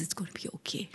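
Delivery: very soft, whispered comfort; hushed reassurance. Retold or imitated. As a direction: whisper it, as a mother soothing her child. Mother comforts crying child.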